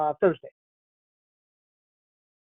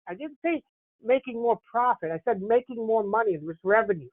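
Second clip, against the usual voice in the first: ordinary talk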